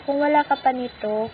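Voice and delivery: ordinary delivery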